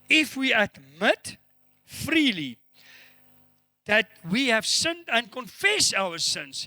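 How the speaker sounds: slow, weighty declaiming of the verse; emphatic scripture reading. Acted. Preacher reads John's verse on confession to church, slow and emphatic. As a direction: stretch the key words, phrase by phrase.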